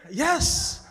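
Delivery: loud, high yes; emphatic yes. Real, not acted. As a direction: punch the yes with delight. Emphatic affirmation after laugh.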